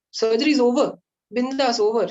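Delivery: high, loud, chirpy announcement; breezy nurse. Retold or imitated. Nurse telling the groggy patient it's over, breezy and loud. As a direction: toss it off cheerfully like no big deal.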